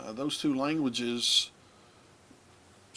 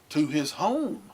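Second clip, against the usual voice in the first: "home" drawn out, higher and louder; emphasis on HOME; 4 semitones higher, 6 dB louder than usual. Shown as a demonstration. Teacher showing how English would emphasize a fronted phrase, emphatic. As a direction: lean hard and slow on "home", fronted first. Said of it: "That's the way we would emphasize it".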